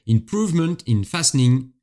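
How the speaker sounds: English accent, read out carefully; accent anglais. Shown as a demonstration. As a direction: put on your best English accent. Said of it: "tenez-vous bien avec mon accent anglais". (avec l'accent anglais)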